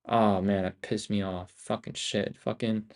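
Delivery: usual speaking voice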